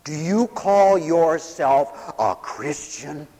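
slow, deliberate challenge; pointed reproach. Acted. Someone confronts a despairing preacher, slow pointed reproach. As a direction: draw out every word accusingly. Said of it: "maybe someone will come up and say".

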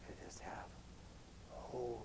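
near-whisper, very soft and low; hushed calm. Shown as a demonstration. Preacher showing the congregation holy calm, whispered. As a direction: let it sink to a serene whisper.

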